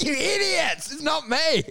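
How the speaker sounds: a pretend huff at customs; mock scorn. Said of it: "I'm like".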